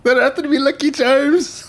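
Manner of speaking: high, hammed-up Irish lilt; cereal-mascot Irish accent. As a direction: do a silly, high-pitched Irishman. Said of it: "in Irish accent".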